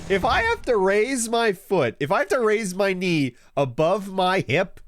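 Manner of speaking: high, indignant rant; mock outrage. Real, not acted.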